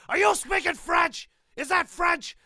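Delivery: high, loud exasperated yell; explosive angry shout. Retold or imitated. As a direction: erupt at him in outrage. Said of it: "he's so explosively angry".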